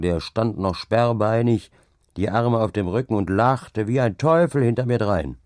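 plain reading voice

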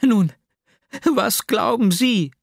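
high, loud, drawn-out challenge; agitated demand. A narrator's reading. Heroine demands an answer, very agitated. (aufgebrachte, fordernde Frage)

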